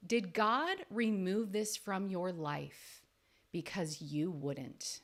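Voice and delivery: slow, weighty delivery, letting the question land; slow, pointed question. Shown as a demonstration. Coach posing a hard question to her listener, slow and weighty. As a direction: drop it slowly so it stings. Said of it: "Ooh, that hits".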